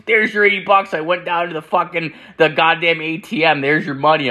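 high-pitched, mildly exasperated retelling; insistent customer. Retold or imitated. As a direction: mimic a customer impatiently handing over cash. Acted customer thrusting money at him.

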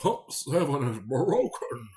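slow, loud, high, put-on old English accent; old English man voice. Retelling the broken-pump complaint in an old English man voice. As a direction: do the angry old Englishman again, stretched out. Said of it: "He sounded like an old English man".

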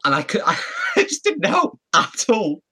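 high, drawn-out words broken by laughter; laughing. Real, not acted. Admitting to friends he made it worse, laughing. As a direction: crack up while owning up. Cracking up at own embarrassing story.

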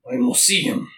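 a lightly put-on, hammy Polish accent, quick at the end; mock Polish accent; terrible Polish accent. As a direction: quote the professor in a shaky Polish accent. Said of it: "It's a terrible accent".

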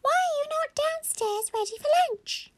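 very high squeaky questioning; squeaky mummy voice. Acted. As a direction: scold in a tiny toy voice. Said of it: "yes, Mummy said".

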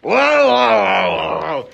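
loud, high, drawn-out whoas; calling for calm. Acted. Host cuts in on two quarrelling guests, shouting them down. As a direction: shout to break up a squabble, stretching each word.

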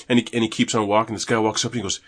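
ordinary talk

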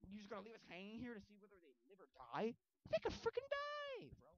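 high, incredulous complaint; exasperated. Retold or imitated. Fan protests the movie's cliffhanger ending, exasperated. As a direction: protest at being left hanging.

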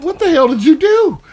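high, mock-outraged wife; wife's reaction. Wife asking what he did, mock-outraged and high. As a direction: squeak it out like a shocked wife. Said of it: "She was like".

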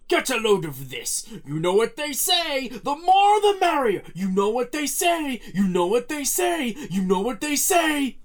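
loud, high, hammed-up villain catchphrases; repeated game voice lines. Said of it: "repeats voice lines like".

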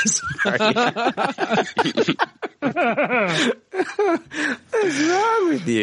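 very high, squeaky words through laughter; helpless laughter. Real, not acted. Host losing it over the cat, helplessly laughing. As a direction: barely get it out for laughing.

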